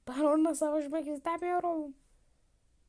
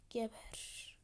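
whisper; 9 dB quieter than usual. Acted.

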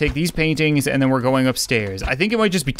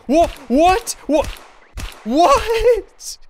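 stunned, can't believe it; shocked disbelief; much slower than usual. Real, not acted. Shot by the neighbor.

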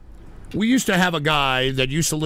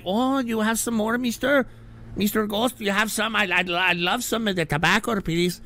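nosy neighbor voice; 5 semitones higher than usual. Retold or imitated. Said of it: "be like".

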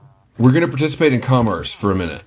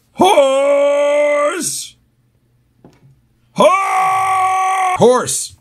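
loud, high shout across a field; calling out; 4 dB louder than usual. Real, not acted. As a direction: yell for the missing horse. Calling lost horse.

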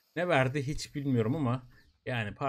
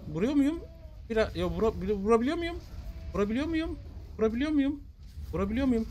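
anxious high questioning; 8 semitones higher, faster than usual. Real, not acted. Anxious about hitting boss.

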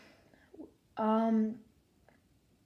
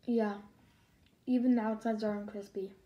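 talking while chewing food; words muffled by food; mouth full, chewing. Real, not acted. Seen 1: bites tot at -2.0; jaw keeps chewing, tot in hand.

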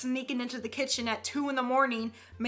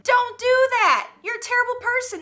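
mock scolding; 9 semitones higher, 3 dB louder than usual. Scolding late customers.

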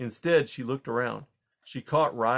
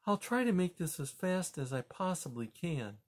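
soft, higher earnest promise; quiet sincere vow, softer; 6 semitones higher, 5 dB quieter than usual. A narrator's reading. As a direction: promise it softly to him. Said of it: "she promised".

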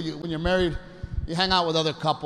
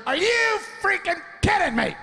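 angry yell; 13 semitones higher than usual. Retold or imitated. Angry wife yells across casino.

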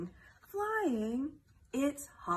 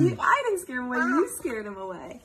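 laughing argument; 10 dB louder than usual. Acted.

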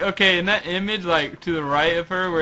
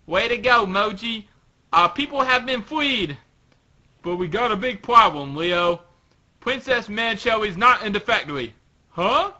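higher-pitched little character voice; raised, lively cartoon dialogue; Leo; 7 semitones higher, 3 dB louder than usual. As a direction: play an earnest cartoon little guy reporting news. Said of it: "Leo says".

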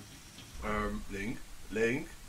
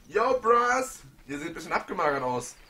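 loud, fast bro greeting; mock bro voice; 5 semitones higher, 7 dB louder than usual. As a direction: greet them like a cocky buddy. Spricht Figur im Spiel an. (lässiger Kumpelgruß, laut)

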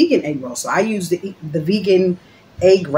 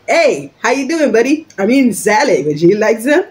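loudly over-friendly mock greeting, pitched up; sarcastic; 4 semitones higher, 4 dB louder than usual. Annoyed eater offering salad to an imagined buddy, sarcastic. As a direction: play fake-chummy to needle someone. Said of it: "Forgive me for my sarcasm".

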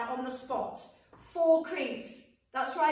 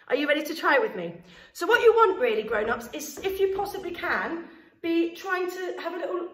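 ordinary talk

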